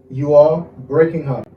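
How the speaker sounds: slow, drawn-out teaching delivery; measured language-teacher pace; slow model phrase. Shown as a demonstration. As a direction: spell out each word for learners. Teacher models English phrase slowly.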